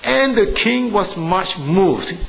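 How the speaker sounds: solemn Bible reading voice; scripture reading. Shown as a demonstration. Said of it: "I read that verse for you".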